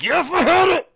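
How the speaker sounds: high, loud mocking snap; mock angry retort. Cashier telling off the customer, high loud mock anger. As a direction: spit back at the customer.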